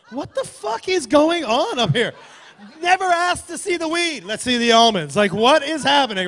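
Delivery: loud, incredulous comic rant; exasperated outburst. Acted. Traveler baffled by almond inspection, exasperated rant. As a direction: blow up in acted disbelief.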